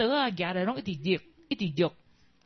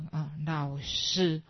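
慢慢地、一字一字叫"老师"; drawn-out careful "啊，老师" after being told to slow down; slow careful call; 4 semitones lower, much slower than usual. Retold or imitated. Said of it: "你再叫一次". (学生放慢速度重新叫老师)

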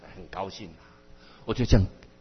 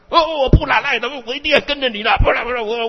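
much higher, whining begging; hammed-up sulky whine; whiny tantrum plea; 8 semitones higher, faster than usual. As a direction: wheedle like a stubborn child. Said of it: "我很会耍赖哦".

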